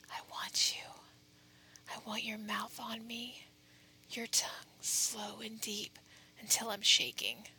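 close, breathy whisper right at the ear; whispering into your ear. Acted. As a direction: whisper it straight into their ear. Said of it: "whispering into your ear like this".

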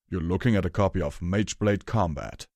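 gruff voice with a touch of pride; proud. A narrator's reading. Hammer Chain shows Rarity the book, proud. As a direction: present it with understated pride. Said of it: "Hammer Chain said proudly".

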